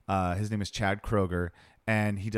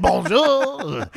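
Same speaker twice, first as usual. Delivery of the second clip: high, loud French candlestick voice; talking candlestick; 11 semitones higher, 8 dB louder than usual. Retold or imitated. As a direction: belt it like a cartoon French candlestick. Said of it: "like the candlestick from Beauty and the Beast".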